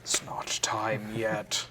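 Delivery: slow whisper with a giggle; laughing whisper. Real, not acted. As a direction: whisper it slowly, half laughing.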